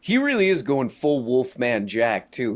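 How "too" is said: usual speaking voice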